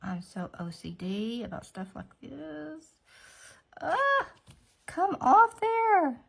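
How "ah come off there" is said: high, loud, drawn-out fussing; loud, high-pitched frustrated plea; exasperated outburst. Real, not acted. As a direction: scold the stubborn thing, high and loud. Fussing at stuck polish.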